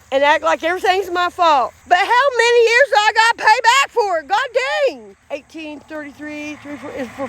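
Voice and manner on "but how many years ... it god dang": higher, fed-up protest; exasperated outburst. Real, not acted. Vlogger fed up with paying for old guilt, exasperated. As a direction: burst out, high and exasperated.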